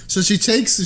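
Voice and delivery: ordinary talk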